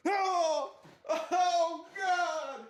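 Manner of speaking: high, loud wail of dismay; laughing, drawn-out mock shock; mock horror. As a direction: cry out in hammed-up horror. Horrified reaction to plot twist.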